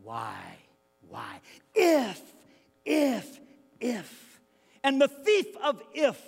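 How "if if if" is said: high and loud "If", fading; preacher driving the word home; hammered repetition. Real, not acted. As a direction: punch each "if" and let it fall. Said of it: "emphatic repetition".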